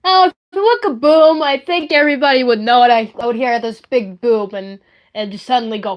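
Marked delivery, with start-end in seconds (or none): none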